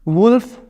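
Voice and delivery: वुल्फ with a short oo as in book, rising at the end; teacher says वुल्फ with the short u of बुक; wolf demo. Shown as a demonstration. Teacher demonstrating the book vowel in wolf, clearly. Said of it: "ये ऊ sound जिसे हम कहते हैं".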